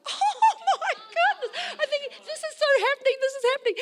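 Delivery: high, giddy excitement; high-pitched, hammed-up glee; thrilled. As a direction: squeal it as if bursting inside. Secret thrill after mum agrees.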